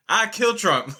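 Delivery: put-on high-pitched, amused retelling of a girl; young girl's vow. Quoting the young refugee student to the host, high-pitched bold declaration. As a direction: say it high, on the edge of laughter.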